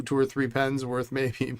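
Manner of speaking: ordinary talk, nothing marked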